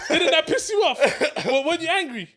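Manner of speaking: high, loud laughter through every word; laughing pleading. Real, not acted. Begging his friend to be honest, laughing loud. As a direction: beg through fits of laughter.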